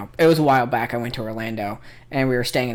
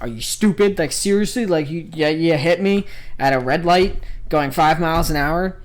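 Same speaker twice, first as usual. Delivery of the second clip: raised, louder retold rant; imitated angry yelling; 4 semitones higher, 6 dB louder than usual. Driver berating the man who hit him, mock angry yell. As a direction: act out an incredulous driver's tirade. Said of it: "yelling at the other dude".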